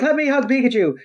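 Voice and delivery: high mock kid voice; imitating a child; eager kid fan. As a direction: plead like a little fan. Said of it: "they're like".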